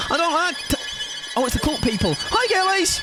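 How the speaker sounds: a startled squeal far above her own voice; squeaky high voice. Acted. Streamer squealing at the cult characters in the game.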